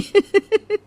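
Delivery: high-pitched acted titter; short, high snicker; mocking giggle. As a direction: laugh at a friend's expense. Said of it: "तेज तेज हंसने लगती".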